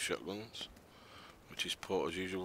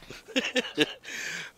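breathless laugh. Real, not acted. Breathless laugh during the fight.

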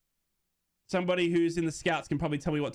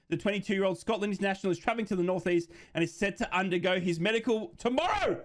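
higher-pitched announcer delivery, lifting on the last word; news read; 5 semitones higher than usual. Acted. Streamer reading viewers the Tommy Conway transfer report, news announcer style.

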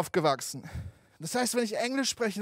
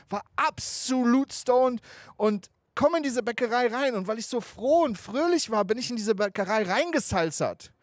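ordinary delivery

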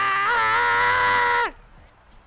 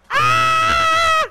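sudden high scream of alarm; high panicked scream; 9 dB louder than usual. Real, not acted. Game goes wrong.

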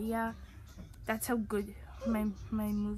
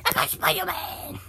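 loud whispered boast; stage-whispered hero boast; excited whisper; 5 semitones higher, 8 dB louder than usual. Pretend. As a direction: hiss it out thrilled. Excited whisper during game.

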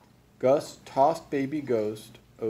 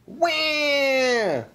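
loud, very high infant wail; baby ghost yell; 5 dB louder than usual. A narrator's reading. Baby ghost woken by noise, loud high wail. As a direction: wail at full volume like a baby. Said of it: "with all this noise and yelled".

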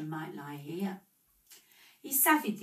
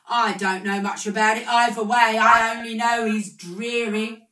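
louder, careless businessman; loud gossip; 4 semitones higher, 12 dB louder than usual. A narrator's reading. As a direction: toss it off loudly, not caring. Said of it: "screeched a great fat Omi Palomi".

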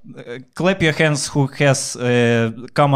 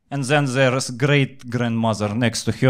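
normal talking voice

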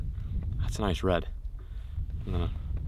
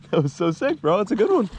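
excited; 9 semitones higher than usual. Real, not acted. Excited over fish catch.

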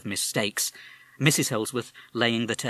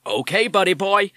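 put-on higher, louder irritated American accent; nettled American parrot; 10 semitones higher, 10 dB louder than usual. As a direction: snap back like an annoyed wise guy. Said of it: "nettled by the policeman's tone".

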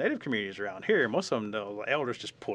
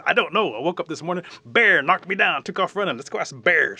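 loud, raised storybook animal voice; excited, higher, hollered reply; running wolf; 7 semitones higher, 13 dB louder than usual. Acted. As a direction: shout a hurried explanation. Wolf answers on the run.

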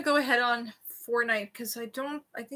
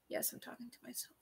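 whispering; 20 dB quieter than usual. Real, not acted. Muttering to herself during piercing.

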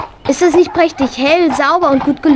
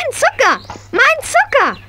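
dismayed cry; 8 semitones higher than usual. Acted. Pony steals her sugar.

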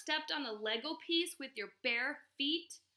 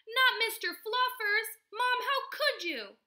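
put-on high, loud, drawn-out child's wail; outraged protest; 10 semitones higher, 8 dB louder, slower than usual. A narrator's reading. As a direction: plead in dramatic horror. Boy protests over his bunny.

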